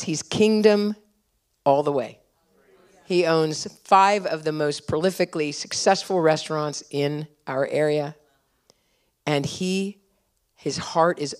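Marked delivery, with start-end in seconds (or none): none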